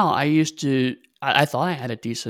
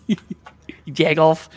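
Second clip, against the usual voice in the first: slow, drawn-out Rust Belt accent; Chicago accent; 3 semitones higher, much slower than usual. Shown as a demonstration. Player echoes the insult in Chicago accent. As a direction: stretch it out in comic Chicago accent. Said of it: "do a bit of Chicago".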